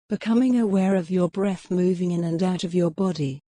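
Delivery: usual speaking voice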